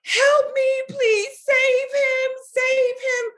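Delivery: high, loud frantic plea, re-enacted; screaming for help. Running through PetSmart to the vet with her dog, screaming desperately. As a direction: cry out desperately at the top of your pitch.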